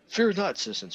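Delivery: different voices. Acted. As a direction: proclaim it high like a caped hero. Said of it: "saying in different voices".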